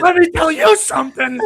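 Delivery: high, loud wrestler hype; wrestling promo. Pretend. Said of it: "That's a wrestling promo".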